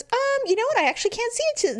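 high, quick hedging; imitating technician. Technician sheepishly admitting the cyst is gone, high and quick. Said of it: "She goes".

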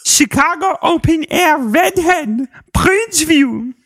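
put-on high sing-song Dutch boy voice. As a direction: read it as a silly Dutch boy. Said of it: "like, Dutch boy voice".

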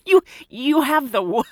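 startled, laughing disbelief; surprised. Retold or imitated. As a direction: ask it in amused disbelief. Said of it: "I was like".

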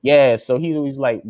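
ordinary talk

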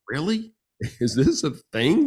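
incredulous, half laughing at the idea; amazed disbelief. Retold or imitated. Husband marvelling at quick repair, amazed laugh. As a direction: pitch leaps up on really, then a laugh. Own amazed reaction retold.

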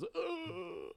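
mock pained groan. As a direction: imitate her choking back a laugh. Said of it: "and then she goes".

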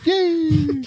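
very high, giddy yay; hammed-up childlike glee; gleeful mock cheer. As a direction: squeal it while zooming about. Acting Dracula running down the halls.

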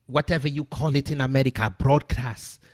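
exaggerated African accent, pleading and indignant; mock African accent. Host fake-hijacks his broadcast, mock African accent. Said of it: "that is my impression of how they sound".

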